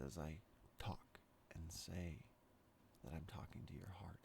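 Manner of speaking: hushed, breathy whisper, slowing then quickening. Acted. Speaker whispering to the listener's heart, hushed whisper. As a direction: breathe it close, as if a secret.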